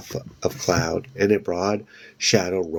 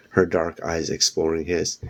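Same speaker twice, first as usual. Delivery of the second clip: plain reading voice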